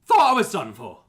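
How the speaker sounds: loud, high-strung cry of a shaken victim; acted, emphatic exclamation of shock. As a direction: blurt it out loudly after a narrow escape. Rescued victim repeats it louder.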